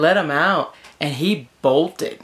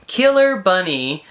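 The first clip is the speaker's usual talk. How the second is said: mock dramatic; 4 semitones higher, 4 dB louder, slower than usual. Punchline of scary story.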